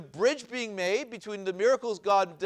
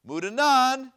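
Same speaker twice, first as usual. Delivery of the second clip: Mudanan higher and much louder; stress on Mudanan; 5 semitones higher, 7 dB louder, slower than usual. Shown as a demonstration. Teacher showing where the emphasis is placed, stress on Mudanan. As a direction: punch out Mudanan, high and loud. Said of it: "where is the emphasis placed".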